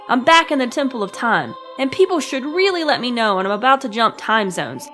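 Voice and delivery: ordinary delivery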